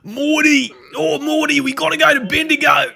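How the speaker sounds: high, loud cartoon shout in an Aussie accent; Aussie Rick and Morty. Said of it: "the Aussie Rick and Morty thing".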